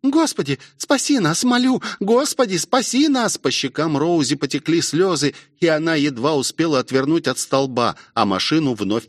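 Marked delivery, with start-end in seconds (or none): 0.0-3.4 put-on high, pleading girl's voice; frantic high plea; desperate prayer. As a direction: beg in a high, frightened voice. Rosie prays in terror, tears follow.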